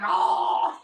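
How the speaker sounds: sharp breathy gasp; startled. Retold or imitated. Mom caught by the flashlight, startled gasp. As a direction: jump at the flashlight. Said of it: "She's like".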